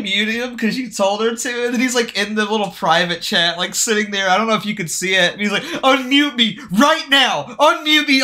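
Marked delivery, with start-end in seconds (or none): 5.6-8.2 slow, higher mock-furious demand; mock seething. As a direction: imitate a man seething with rage. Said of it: "he's just sitting there seething".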